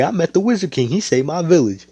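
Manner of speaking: put-on high kid voice boasting about a hero; proud kid. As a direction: brag like a kid to his friends. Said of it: "And you can say".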